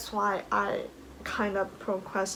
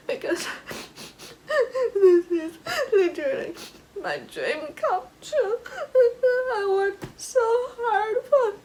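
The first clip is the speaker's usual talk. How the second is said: real crying; 12 semitones higher, 6 dB louder than usual. Real, not acted. Crying while telling the story.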